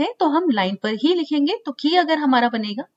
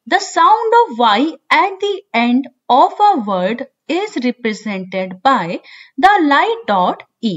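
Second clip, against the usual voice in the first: very slow dictation reading; 3 dB louder, much slower than usual. Shown as a demonstration. Teacher reads English rule aloud.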